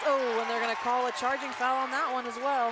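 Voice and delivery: loud, excited foul call. Real, not acted. Surprising foul call in game.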